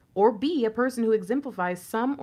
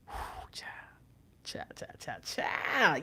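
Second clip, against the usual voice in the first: breathy swoon; 3 semitones lower, 9 dB quieter than usual. Real, not acted. Swooning over his looks.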